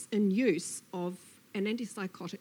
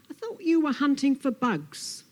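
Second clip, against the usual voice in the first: louder, higher storybook snake voice; puzzled Snake; 5 semitones higher, 7 dB louder than usual. Acted. Snake questions Lizard about hunting bugs, puzzled. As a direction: question Lizard in a raised animated voice.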